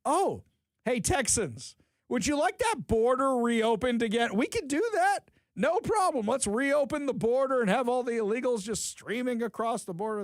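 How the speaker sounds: faux-helpful sarcastic pitch. Real, not acted. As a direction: sell the absurd idea with fake enthusiasm. Sarcastic offer to Texans.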